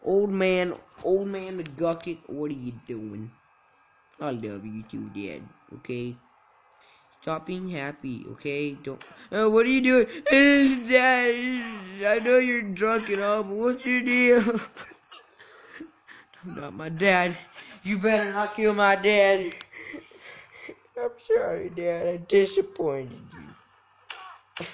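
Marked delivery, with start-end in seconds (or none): 4.2-6.1 softer, lower, dismissive; a quiet mumbled brush-off; mock-sincere murmur to dad; mock tender. Voicing a child to dad. 9.3-14.6 higher, louder, laughing through words; laughing disbelief. Real, not acted. Reacting to drunk dad. 21.0-21.7 much higher squeak on sorry; squeaky apology. Pretend. Mock apology to dad.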